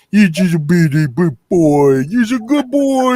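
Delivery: put-on gushing baby voice over the fence to a dog; baby talk to a dog. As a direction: coo at the dog like it's a baby. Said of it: "just talking like that".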